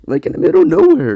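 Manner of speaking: tickled high exclaim; amused squeal. Real, not acted. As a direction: squeak it out in delight. Amused at cats jumping.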